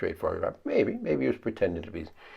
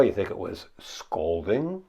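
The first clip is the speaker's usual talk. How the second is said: normal talking voice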